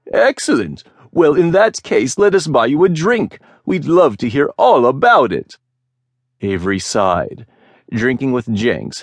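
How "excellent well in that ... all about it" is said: much higher, bright eager chatter; keen traders buttering up the pirate; eager merchants. A narrator's reading. Eager merchants buying drinks.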